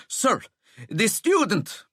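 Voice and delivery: breathless prefect forcing words out; winded and panting. Acted. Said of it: "stop your panting".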